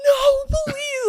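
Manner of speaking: very high, gasping mock plea; high pleading gasp. Friend begs cop not to arrest, high plea. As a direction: beg in a frantic squeal. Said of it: "she was like".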